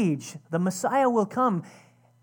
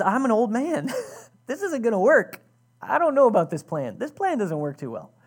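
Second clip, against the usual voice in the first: laughing doubt; 3 semitones higher, 5 dB louder than usual. Acted.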